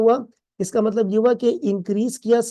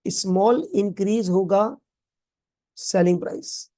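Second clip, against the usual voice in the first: slow dictation. Shown as a demonstration. Teacher dictates the definition slowly.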